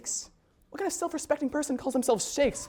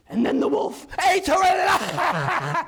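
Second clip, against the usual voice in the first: gleeful shout; 7 semitones higher, 5 dB louder, slower than usual. Acted. Grimm brother's gleeful story climax.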